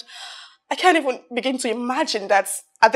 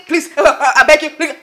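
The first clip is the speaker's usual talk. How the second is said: mock pleading; 8 dB louder, slower than usual. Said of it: "because I'm being nice".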